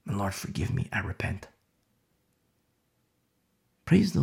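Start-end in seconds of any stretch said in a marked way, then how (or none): 0.1-1.5 hushed prayer. Acted. Preacher acts a repentant prayer.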